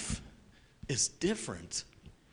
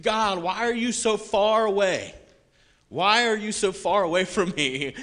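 pleading cry; 6 semitones higher, 8 dB louder than usual. Retold or imitated. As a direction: cry it out to the sky. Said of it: "kinda like David did".

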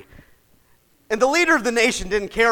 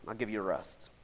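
much softer, hushed echo of the promise; 11 semitones lower, 15 dB quieter than usual. Acted. Preacher echoes the promise Moses heard before, hushed. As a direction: let it land quietly.

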